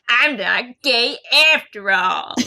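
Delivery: slow, drawn-out mock announcement; mock proud declaration. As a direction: lay it on thick, parodying the character's relief.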